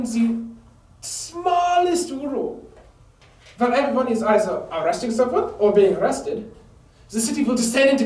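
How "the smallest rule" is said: drawn out, high and menacing; slow theatrical villain menace. Acted. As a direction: savour the words like a threat. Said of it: "enforce all kinds of punishments".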